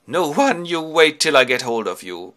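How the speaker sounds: loud, angry roar; giant's threat. Acted. The Cyclops threatens the hidden Ulysses, loud anger. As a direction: bellow a threat into the dark.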